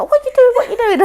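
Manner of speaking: high, loud screaming at her; furious shriek, half laughing at herself; retold scream. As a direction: scream it at the friend who binned the ice cream. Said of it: "screaming at her".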